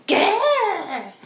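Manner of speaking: loud, high exasperated growl; frustrated growl. Acted. Acted quarrel in script.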